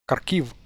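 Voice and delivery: Kharkiv with hard K and stress on -kiv; Western K mispronunciation. Retold or imitated. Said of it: "they say K".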